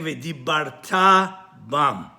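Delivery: slow V'dibarta bam, heavy stress on "ta"; stress on last syllable. Shown as a demonstration. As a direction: stretch and land on the "ta" of V'dibarta, then set bam apart. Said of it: "You should be saying".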